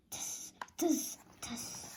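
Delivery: whispered tss tss of mocking monkeys; teasing whispered tsk-tsk. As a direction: hiss it like cheeky monkeys. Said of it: "their fingers back at him".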